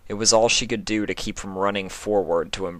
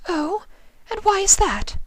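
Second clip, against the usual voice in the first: much higher, bright lady's voice; Rarity voice. Acted. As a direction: play the gracious shop owner.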